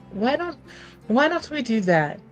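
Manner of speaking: ordinary talk, nothing marked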